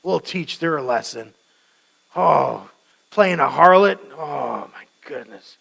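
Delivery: loud, indignant mock condemnation; self-righteous outrage. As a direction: sneer like a smug judge. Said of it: "He goes".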